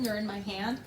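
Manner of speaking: usual speaking voice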